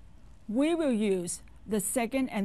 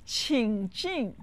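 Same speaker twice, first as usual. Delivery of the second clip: usual speaking voice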